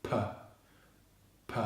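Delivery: isolated "P" as a puff of air; explosive unvoiced P. Shown as a demonstration. Teacher showing how to make the sound, explosive "P". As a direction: pop "P" from closed lips, no voice. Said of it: "with an explosive sound".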